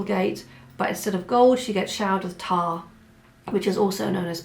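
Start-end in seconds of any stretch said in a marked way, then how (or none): none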